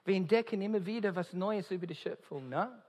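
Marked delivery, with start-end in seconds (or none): none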